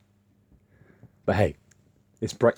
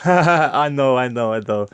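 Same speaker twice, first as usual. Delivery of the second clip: higher, louder mock Romanian accent, conceding; 6 semitones higher, 7 dB louder, slower than usual. Romanian coworker laughing off the jab, in a Romanian accent. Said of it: "I'll do it in his accent".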